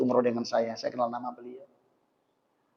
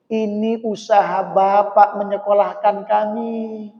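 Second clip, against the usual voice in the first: put-on raised, emotional child's plea; children's moved reply; 7 semitones higher, 11 dB louder than usual. Children explaining to their father why they paid. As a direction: tell him like a grateful child near tears.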